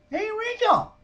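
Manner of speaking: high, loud holler to a neighbour; calling out. Retold or imitated. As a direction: holler his name across the yard.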